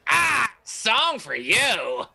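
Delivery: high, slow, drawn-out announcement; showy bard. Acted. Bard steps in front of the half-orc, grandly offering a song. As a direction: announce your song with a flourish.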